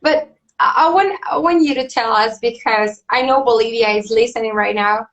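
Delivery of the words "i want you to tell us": ordinary delivery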